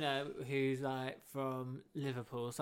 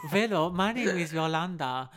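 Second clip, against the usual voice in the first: loud, raised mock German; bad German accent; 4 semitones higher, 7 dB louder than usual. Shown as a demonstration. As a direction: announce yourself in a botched German accent. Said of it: "a German accent".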